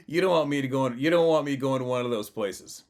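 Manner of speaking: forceful real protest; emphatic warning. Real, not acted. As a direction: warn them off, loud and high. Emphatic warning about attending conventions.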